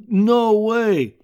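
loud, high burst of disbelief; can't believe it, shout it; shocked disbelief. Retold or imitated. Man who can't believe it's on the radio, loud and shocked.

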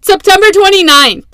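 loud, high outburst of disbelief; exasperated exclamation. Real, not acted. Vlogger stunned at how long editing took, crying out loud. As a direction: throw up your hands and cry out the date. Exasperated at her own delay.

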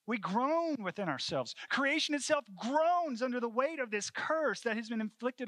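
high, loud lament; anguished, heavy declamation; impassioned preaching. Real, not acted. Preacher at full force on groaning.

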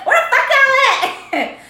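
very high, loud, drawn-out holler; bossy mock yell. Dominant friend looking for the others, laughing mock yell. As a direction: yell it slowly, half laughing.

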